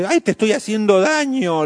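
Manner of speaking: mock hurt. Acting hurt mother.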